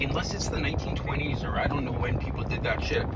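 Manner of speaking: ordinary talk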